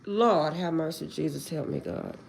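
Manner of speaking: low, soft prayer fading out; hushed plea for mercy. Real, not acted. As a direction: sink into a quiet plea to God. Overwhelmed, praying for mercy.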